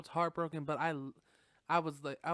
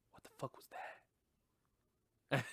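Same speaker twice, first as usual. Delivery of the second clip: hushed alarm; 5 dB quieter, much faster than usual. Acted. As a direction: squeak it quietly, as if spooked. Acting startled girl in bathroom.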